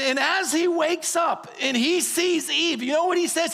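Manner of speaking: ordinary talk, nothing marked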